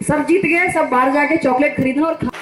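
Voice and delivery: ordinary talk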